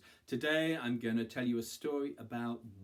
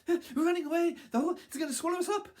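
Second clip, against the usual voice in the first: very high, loud, hurried cry; frantic alarm; 7 dB louder, much faster than usual. A narrator's reading. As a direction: yell it while fleeing in panic. Fleeing animals answer the horses.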